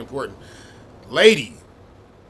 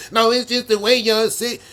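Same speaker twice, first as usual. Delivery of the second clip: mock whiny woman, higher and louder; mocking woman's voice; 8 semitones higher, 6 dB louder than usual. Host mocking a woman brushing off the truth, mock whiny. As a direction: lightly mimic a defensive woman.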